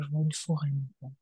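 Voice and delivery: soft, quick, half to herself; murmured fast and low; quiet murmur. Real, not acted. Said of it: "Tu dis?".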